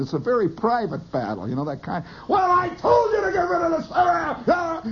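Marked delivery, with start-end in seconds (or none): 2.3-4.8 high, strained mock holler; yelling on phone. Caller in a very private knockdown battle, mock yelling. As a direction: burst into a high-pitched phone tirade. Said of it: "they're yelling".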